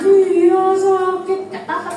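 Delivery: put-on high, slow, loud sing-song to a hurt child; hammed-up parent cooing yes it hurts; mock soothing parent. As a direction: stretch it out like coaxing a crying kid. Adult humoring a hurt child.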